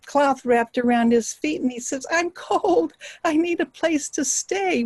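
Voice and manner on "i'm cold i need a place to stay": high-pitched, pitiful beggar voice; acted needy stranger; pleading beggar. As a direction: plead for shelter in a raised voice. Wretched beggar pleads for shelter.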